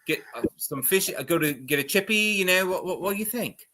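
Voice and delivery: a rough Scottish brogue put on for a flirt; Scottish accent. Dwarf flirting with the lead werewolf in a Scottish accent. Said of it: "Nick hates all of my accents".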